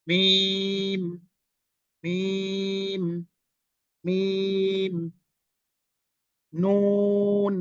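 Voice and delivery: slow, stretched Arabic letter names; teacher modelling Arabic letters slowly; drawn-out letter names. Shown as a demonstration. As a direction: hold each letter name long, as a recitation model. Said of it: "নুন উচ্চারণ সময় আমার দিকে খেয়াল করুন".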